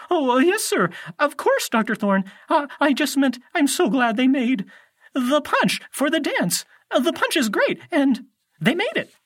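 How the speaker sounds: very high, loud, stammering cover-up; flustered. A narrator's reading. Grover covering his slip to Doctor Thorne, flustered. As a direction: scramble for an excuse in a high voice.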